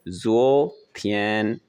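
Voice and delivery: जो slowly rising, थियन held level; Chinese tone demo. Shown as a demonstration. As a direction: stretch out जो upward, then sustain थियन evenly. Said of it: "So, ये बन गया". (जो उठते सुर में, थियन सपाट और लंबा)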